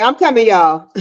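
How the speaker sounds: usual speaking voice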